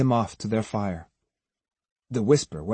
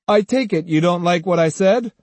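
higher, amused needling; grinning; 6 semitones higher, 4 dB louder than usual. A narrator's reading. Swordsman looking down at an angry samurai, grinning. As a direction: say it through a grin. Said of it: "Kojiro looked down and grinned".

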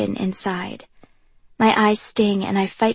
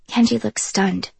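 ordinary delivery

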